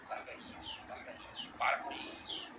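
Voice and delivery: soft urgent whisper; whispered inner voice. Acted. God urging the listener to drop it, whispered. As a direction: whisper it like a conscience. Voicing God's insistent inner voice.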